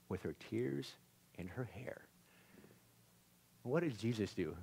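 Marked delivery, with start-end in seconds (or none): 0.1-2.0 barely voiced, tender hush; hushed whisper. Real, not acted. Preacher describing the woman's tears, whispering softly. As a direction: let it drop to a whisper.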